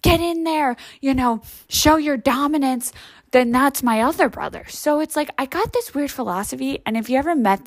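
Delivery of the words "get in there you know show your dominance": mock pep talk. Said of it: "has always been like".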